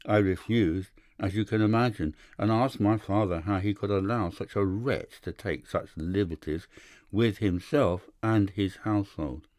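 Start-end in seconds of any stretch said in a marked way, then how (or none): none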